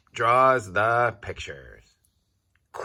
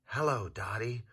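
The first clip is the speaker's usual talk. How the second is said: soft, hushed whisper; 3 semitones higher, 10 dB quieter than usual. A narrator's reading. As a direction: barely breathe the words. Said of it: "whispers the quiet quail".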